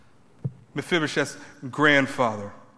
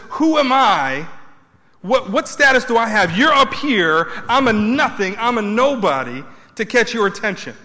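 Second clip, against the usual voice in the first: raised, pleading voice of a humble David; 6 semitones higher, 6 dB louder than usual. Retold or imitated. David pleading with King Saul that he is nobody, loud plea. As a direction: beg the king, I'm nothing.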